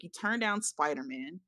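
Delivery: normal talking voice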